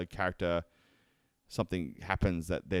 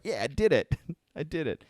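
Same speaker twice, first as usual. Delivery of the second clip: higher-pitched little victory cry with a laugh; mock triumph; 7 semitones higher than usual. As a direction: gleefully celebrate a small win. Acting out triumph after partner breaks.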